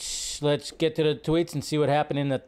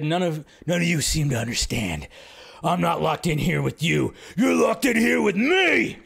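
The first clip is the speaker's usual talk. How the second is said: Rorschach impression. Streamer acts out Rorschach's prison line, then yells it. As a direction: growl it out like the masked vigilante, then explode. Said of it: "[name] impression right there".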